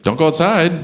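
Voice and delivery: a hint of a parent's warning. Voices parents warning their child.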